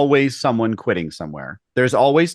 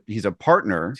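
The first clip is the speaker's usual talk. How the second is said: ordinary talk, nothing marked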